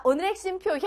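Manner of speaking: ordinary talk, nothing marked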